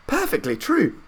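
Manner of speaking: put-on very high old woman's voice; Grandma Josephine crying. A narrator's reading. Grandma Josephine agreeing with Grandpa Joe, very high old woman's voice. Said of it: "cried Grandma Josephine".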